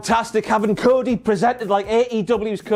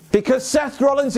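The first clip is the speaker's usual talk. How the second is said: ordinary talk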